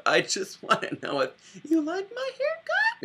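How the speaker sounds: very high squeaky put-on voice; campy hairdresser. Imitating the barber in the elevator, high hammed-up squeal. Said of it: "he just goes".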